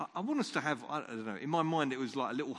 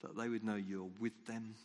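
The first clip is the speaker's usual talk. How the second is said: quiet prayer; 3 semitones lower, 5 dB quieter than usual. Acted. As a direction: pray gently, almost under the breath. Leads a quiet closing prayer.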